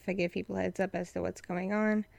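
ordinary talk

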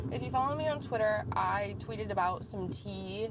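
ordinary talk, nothing marked

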